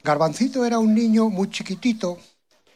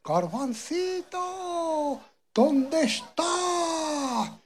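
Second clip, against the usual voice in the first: long, drawn-out call, much higher and slow; mother calling out; 10 semitones higher, much slower than usual. Acted. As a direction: call for a lost child far away, stretching every word. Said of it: "Y le decía".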